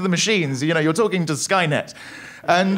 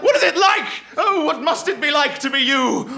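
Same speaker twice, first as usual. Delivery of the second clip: higher, laughing incredulous jab; exasperated mock; 9 semitones higher than usual. Comedian losing it at the banker crowd, high incredulous mockery. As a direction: lose it with the rich crowd, mock-baffled. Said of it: "I was just like".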